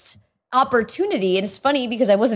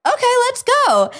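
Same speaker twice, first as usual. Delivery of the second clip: high-pitched eager go-ahead; playfully hyped reaction; acted excitement; 4 dB louder than usual. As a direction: launch into it, high and keen.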